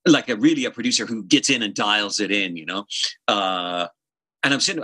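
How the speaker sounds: ordinary delivery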